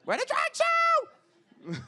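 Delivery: very high, slow laughing squeal; high-pitched drag-queen squeal; campy shriek. Acted. Shrieks punchline to crowd.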